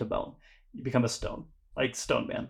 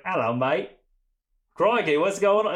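hammed-up British accent, jumping higher and louder on the second phrase; mock British accent; 6 semitones higher, 5 dB louder than usual. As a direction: put on a playful fake British accent. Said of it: "wakes up with, like, a perfect accent".

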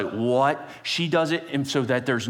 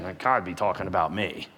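smug thought; 7 semitones lower than usual. Acted. Haman's smug inner thought.

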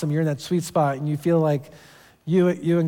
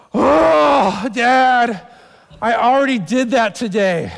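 put-on higher, louder child whine, stretched out; whiny kid; 6 semitones higher, 6 dB louder, much slower than usual. Son Max whining to his dad, a loud childish whine. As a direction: play the kid protesting with a long whine.

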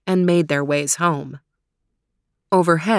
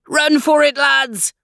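crying out; 8 semitones higher than usual. Acted. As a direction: warn the lads, urgent. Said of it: "cried Jock".

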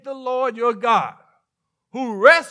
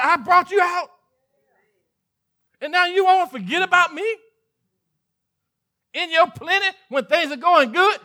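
higher pitched, building sermon cry; preaching at full force; 6 semitones higher than usual. Acted. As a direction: preach it as God's hurt complaint. Preaching God's complaint rising.

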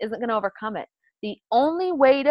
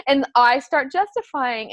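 ordinary delivery

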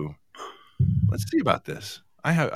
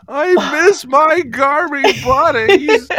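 high, loud, slow whimper; mock wail; 13 semitones higher, 8 dB louder, much slower than usual. Host retelling missing her friends, high slow mock wail. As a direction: wail it like a hammed-up sob. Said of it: "I was like".

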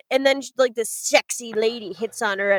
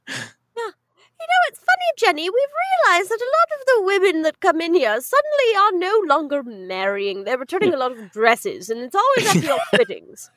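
much higher, put-on shop manager voice; bridal shop manager; 6 semitones higher than usual. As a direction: play the prim manager gossiping to Jenny.

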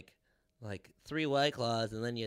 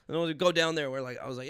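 ordinary talk

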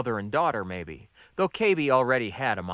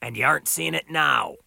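louder, higher grumpy retort; grousing; 4 semitones higher, 5 dB louder than usual. A narrator's reading. Boss grouses at Gonda, louder retort. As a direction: grouse back defensively. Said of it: "he groused".

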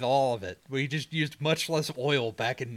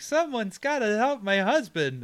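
higher-pitched cry for help; mock distressed wife, pitched up; pleading wife; 8 semitones higher than usual. Wife calling for help in a gas-station fight, mock pleading.

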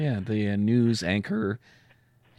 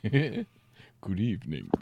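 put-on Transylvanian accent; 4 dB quieter than usual. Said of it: "With a slight Transylvanian accent".

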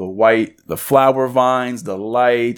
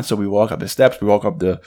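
ordinary talk, nothing marked

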